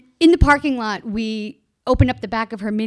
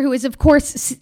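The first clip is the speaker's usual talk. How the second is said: normal talking voice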